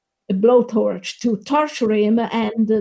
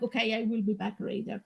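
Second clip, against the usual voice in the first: quick, much softer mock brush-off; defeated Chucky; 2 semitones lower, 14 dB quieter, much faster than usual. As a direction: mutter it fast and give up on her. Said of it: "and he was like".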